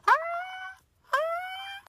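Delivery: thin high strained gasps; voiceless cry for help. Retold or imitated. Paralysed sleeper trying to scream, faint high wheezes. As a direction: try to scream with no voice coming out. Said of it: "le estaba haciendo así".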